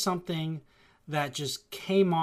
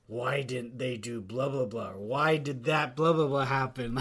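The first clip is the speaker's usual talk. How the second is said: steaming grumble; 5 semitones lower, 4 dB quieter than usual. Retold or imitated.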